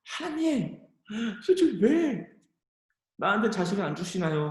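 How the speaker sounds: high, pleading cry to God; tearful plea. Retold or imitated. Hannah crying out to God, tearful. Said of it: "꺼이꺼이 모든 걸 다 토해내면서".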